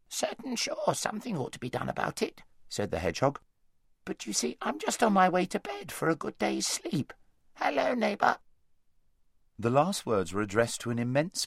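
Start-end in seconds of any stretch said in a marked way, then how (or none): 0.1-2.4 wheezy hedgehog. A narrator's reading. 4.0-8.4 high, wheezy sleepy hedgehog; an easygoing hedgehog ready for bed. A narrator's reading.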